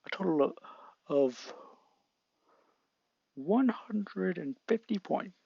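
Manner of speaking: a hint of a proud announcer; lightly voiced All Might. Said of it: "All Might appears".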